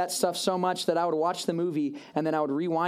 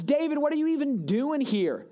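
mocking big brother; 8 semitones higher than usual. Eliab mocking his little brother.

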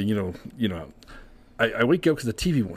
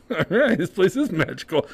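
delighted; 8 semitones higher than usual. Retold or imitated. Retells delighted reaction to woman approaching.

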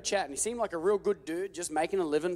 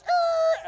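high, loud tire screech noise; imitated tire screech; 7 dB louder than usual. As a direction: screech like spinning tires. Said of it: "there was the tires".

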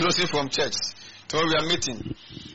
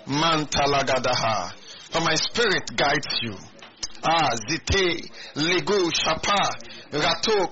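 raised, ringing prophetic declaration; prophesying; 4 semitones higher than usual. Acted. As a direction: proclaim it as the voice of God. Prophecy given in the Lord's voice.

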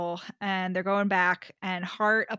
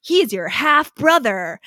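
loud, slow mystery reveal; Beth's hammed-up reveal to the brothers; dramatic reveal; 3 semitones higher, 11 dB louder, slower than usual. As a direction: unveil it like a detective.

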